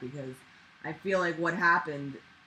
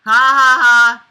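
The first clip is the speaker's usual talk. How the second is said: very loud, higher performed shout; loud yell; 5 semitones higher, 21 dB louder, much slower than usual. Acted. Trainee yelling on the instructor's order, full-volume shout. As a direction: belt out each syllable at full volume.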